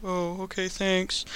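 very soft, bashful mumble; mock-sheepish quiet reply; Spider-Man. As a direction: accept it shyly, barely voiced. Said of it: "And he's like".

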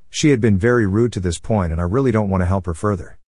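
plain reading voice

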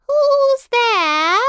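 put-on very high, loud old granny call; grandmother. A narrator's reading. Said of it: "The good grandmother".

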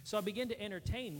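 ordinary talk

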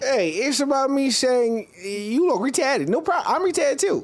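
goofy put-on voice. Buddy describing the show in a high dopey voice. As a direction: drag it out like a dopey guy.

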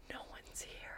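mimicked creepy whisper; barely audible, breath only; whispering to itself. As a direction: act out a secretive whisper. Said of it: "whispering to itself".

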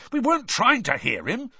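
indignant outburst; indignantly. A narrator's reading. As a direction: defend yourself hotly. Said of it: "said Ron indignantly".

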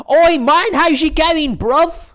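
very high, loud mock English accent; over-hype a London bruv; hyped English accent. Mocking an annoying English prankster, very high and loud. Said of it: "you add a fucking accent".

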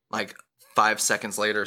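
normal talking voice

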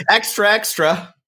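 put-on high, laughing old-time newsboy call; newsboy cry. Joining the bit as a newsboy, high laughing paper-boy cry. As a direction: shout it like a street kid selling papers. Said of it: "That might have been British".

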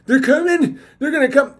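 high, loud fretful alarm; hammed-up panicky warning; mock panic. Mocking worriers expecting a ban, hammed-up fear.

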